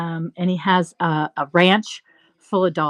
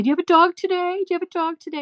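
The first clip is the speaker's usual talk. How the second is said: much higher, sweet sing-song question; imitating cheerful court staff; 10 semitones higher, faster than usual. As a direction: coo it like greeting a favorite visitor. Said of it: "everybody would say".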